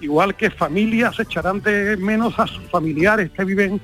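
usual speaking voice

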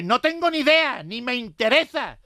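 high, loud, dismissive yell; shouting soldier. Retold or imitated. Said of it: "le gritó el soldado".

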